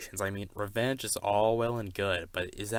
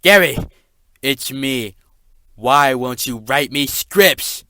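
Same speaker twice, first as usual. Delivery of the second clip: very loud, slow pleading yell; calling out loud; 4 semitones higher, 15 dB louder, slower than usual. Acted. Dragon character yelling through the office door at Gary, very loud and slow. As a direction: shout through the door, then plead slowly.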